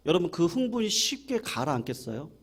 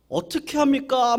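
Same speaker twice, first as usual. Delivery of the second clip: panicked cry; 8 semitones higher, 6 dB louder than usual. Retold or imitated. Disciples panic in the storm.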